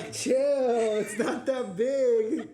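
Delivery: high, loud, drawn-out yell; laughing protest. Real, not acted. Co-host telling her to calm down, laughing protest. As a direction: cry out in amused protest.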